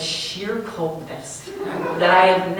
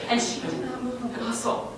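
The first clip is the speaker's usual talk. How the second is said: ordinary talk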